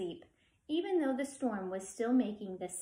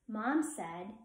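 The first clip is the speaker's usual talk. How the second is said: ordinary delivery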